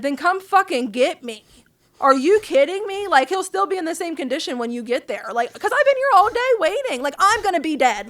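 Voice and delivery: furious retold yelling. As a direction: yell it down the line like you've been abandoned all day. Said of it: "I was so mad at everybody".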